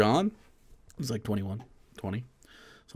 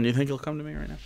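usual speaking voice

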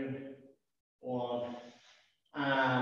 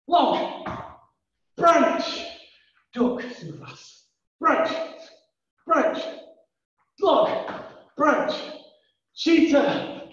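jungle game calls; 9 semitones higher, much slower than usual. Acted. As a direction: call the jungle moves with energy.

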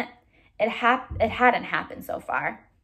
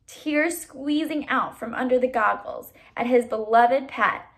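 usual speaking voice

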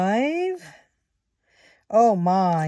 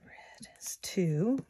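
slow soft whisper; 5 dB quieter, slower than usual. Real, not acted. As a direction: breathe it out in a hush. Quiet counting during game.

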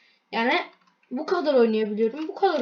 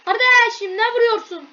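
high, loud yelp of complaint; alarmed protest; 9 semitones higher, 5 dB louder than usual. Real, not acted. Player protesting to a teammate who shoots him, alarmed protest. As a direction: cry out at the one shooting you.

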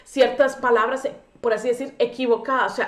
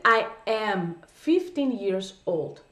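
put-on "I am fifteen years old" said very slowly, word by word; slow correct version; 3 semitones lower, much slower than usual. Shown as a demonstration. Teacher giving students the correct phrase very slowly. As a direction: model the right phrase very slowly. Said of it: "Lo correcto es decir".

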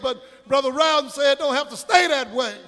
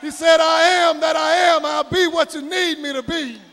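climactic sermon shout, building louder in short rhythmic bursts; full-force preaching; 2 semitones higher, 7 dB louder than usual. Real, not acted. Preacher closing with praise of Jesus, asking do you know him, full-force preaching. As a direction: cry out each line of praise to rouse the room.

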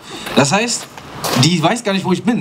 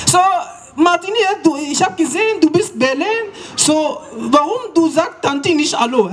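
mother's voice; 10 semitones higher than usual. Retold or imitated. Mum scolds son about blond hair and greetings. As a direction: scold him for not greeting auntie.

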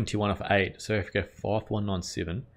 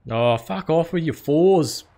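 loud, higher, slow exasperated jab; frustrated outburst; 8 semitones higher, 7 dB louder, slower than usual. Real, not acted. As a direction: vent at the batter's boundaries. Opponent hits another boundary.

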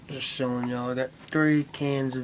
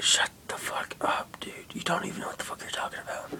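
hushed, fast unvoiced whisper to a friend; mock-secret whispered put-down; 8 dB quieter than usual. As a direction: breathe it out with no voice, rushed. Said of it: "Why are we whispering?".

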